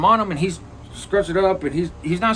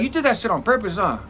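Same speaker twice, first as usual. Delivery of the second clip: accusing; 7 semitones higher than usual. Retold or imitated. Accusing the cellmate after the setup.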